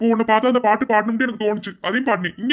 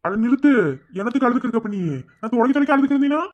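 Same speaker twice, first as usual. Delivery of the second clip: mock scolding; 5 semitones higher, 4 dB louder, faster than usual. Scolding crying girl half-jokingly.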